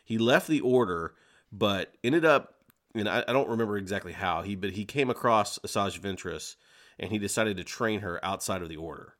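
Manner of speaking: ordinary talk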